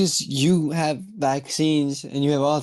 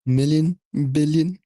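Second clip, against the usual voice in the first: Trump words. Retold or imitated. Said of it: "some of the way he says words".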